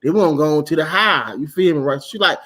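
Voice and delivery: ordinary talk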